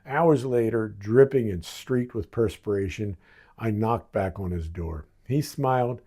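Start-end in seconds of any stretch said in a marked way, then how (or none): none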